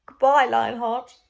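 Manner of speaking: slow, loud heartfelt goodbye; tender farewell. A narrator's reading. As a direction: say goodbye for ever. Queen's farewell.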